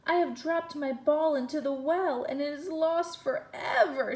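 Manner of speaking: loud, high wail over a lost ball; wailing princess. A narrator's reading. Princess wailing to the frog, loud and high. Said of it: "she wailed".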